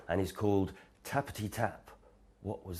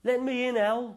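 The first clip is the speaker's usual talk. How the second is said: high, loud call through the door; 6 dB louder than usual. Acted. Rabbit outside in the rain calling to Owl, high and loud. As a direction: call to Owl over the storm. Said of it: "called Rabbit".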